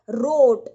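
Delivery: "रोट" with a long gliding au; long au glide in "रोट", as in wrote; au sound. Shown as a demonstration. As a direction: say "रोट" loud, rounding the vowel into au. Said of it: "औ का sound आया ना".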